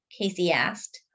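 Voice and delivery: ordinary delivery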